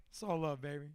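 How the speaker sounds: low, soft, blissed-out line; imitated laid-back sweet talk; mellow client. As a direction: say it low and easy, all love. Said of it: "and he's like".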